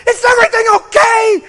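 overwhelmed pastor yelling down the phone; screaming. Retold or imitated. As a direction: shout it, overwhelmed. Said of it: "I'm screaming at this poor lady".

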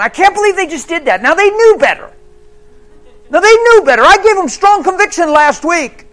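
loud, high, hammed-up indignation; mock outraged rant. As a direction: build to a full shouted tirade. Mock God ranting about people's failures.

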